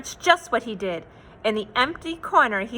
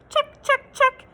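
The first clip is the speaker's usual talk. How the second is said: high, loud, bright-called check; cheery check; 13 semitones higher, 3 dB louder than usual. Acted. Narrator to kids, ticking off Moose's list, cheerfully. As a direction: chirp it out like ticking a box.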